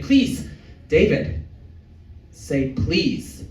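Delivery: scolding wife. Retold or imitated. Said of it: "She's like".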